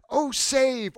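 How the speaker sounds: high, pleading cry. Acted. Crowd waving palm branches, crying out in appeal. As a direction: lift the pitch into an appeal. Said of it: "cried out".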